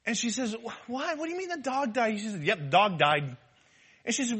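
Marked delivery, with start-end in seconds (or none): 0.5-2.2 higher-pitched, softly stammered disbelief; mock shocked wife. Wife hearing of the dog's death, mock shock. Wife hears bad news on phone. 2.4-3.4 matter-of-fact husband, lower pitch; blunt confirmation. Retold or imitated. Husband confirming the news to his wife, blunt. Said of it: "have to say it like that".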